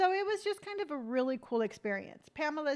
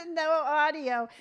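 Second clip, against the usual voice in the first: laughing; 5 semitones higher, 7 dB louder than usual. Real, not acted. GoPro audio failed, laughs.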